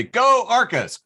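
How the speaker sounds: gleeful rooting shout; cheering. Retold or imitated. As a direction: cheer it out high and loud. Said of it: "one notable person did say".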